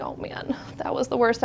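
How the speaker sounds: hushed, whispery imitation of a coworker; acted under-the-breath groaning quote; retold dismay. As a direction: half-whisper it as a coworker's relieved aside. Retells coworker's reaction after hanging up.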